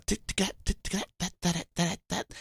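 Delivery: high, soft tap-dance clicks; staccato mouth-made tapping; imitated tap shoes. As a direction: tick out a tap rhythm lightly. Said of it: "I hear the tap shoes".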